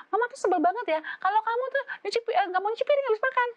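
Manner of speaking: high, loud, fast scolding; hammed-up exasperated mother; shrieking nag mother. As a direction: rattle off the complaint like an exasperated mom.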